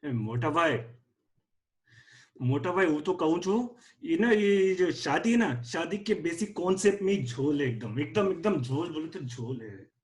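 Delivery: put-on mildly scared Gujarati accent; nervous Gujarati bhai. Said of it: "your accent is Gujarati".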